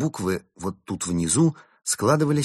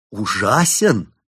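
indignant disbelief; 4 semitones higher, 7 dB louder, much slower than usual. Acted. Benedict indignant at the plot.

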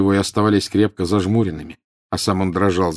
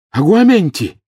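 high, loud urgent incantation; desperate shouted command; exclaimed spell; 11 semitones higher, 7 dB louder than usual. A narrator's reading. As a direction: cry the spell out sharply. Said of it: "ещё раз воскликнул Гарри".

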